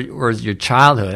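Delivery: usual speaking voice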